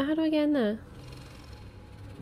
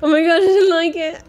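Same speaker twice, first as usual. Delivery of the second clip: high, loud crying yell; terrified shout; 7 semitones higher, 16 dB louder than usual. Real, not acted. Player jumped by the creature, crying terrified yell. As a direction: shriek out your fright. Scared by monster.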